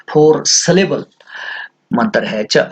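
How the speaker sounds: ordinary delivery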